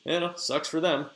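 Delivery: casual, half-hearted mock sympathy; offhand, lightly acted indifference; unbothered shrug. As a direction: shrug it off like it's not your problem. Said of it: "doesn't seem to feel too bad about it".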